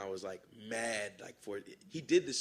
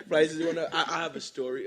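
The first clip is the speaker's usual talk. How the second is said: laughing; 7 semitones higher, 12 dB louder than usual. Real, not acted. Rapper laughing as he repeats his meme nickname. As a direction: laugh while repeating the name, louder.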